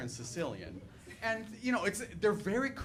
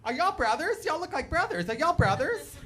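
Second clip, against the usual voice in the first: Southern folks asking; 11 semitones higher, 5 dB louder than usual. Retold or imitated. Mimicking Southern strangers, high mock Southern accent. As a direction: play the nosy Southern local. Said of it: "They're just like".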